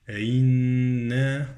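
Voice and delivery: slow "أئنا" hummed through the n; ghunnah on the nun. Shown as a demonstration. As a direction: stretch the nasal n of "أئنا". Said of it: "النون بغنة".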